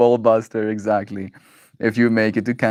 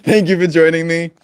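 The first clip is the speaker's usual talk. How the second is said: laughing; 7 semitones higher, 5 dB louder than usual. Real, not acted. Signing off through a laughing fit.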